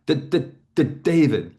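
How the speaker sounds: a light demo of a client's stutter; a blocked, repeating "D-, d-, d-, David"; imitating a stutter. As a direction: repeat the D sound before the name. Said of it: "I wanna show you how she would speak".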